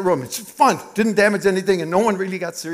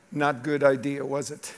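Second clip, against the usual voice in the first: gentle rebuke; 4 semitones lower, 6 dB quieter than usual. Retold or imitated. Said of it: "the Spirit was looking at me too and saying".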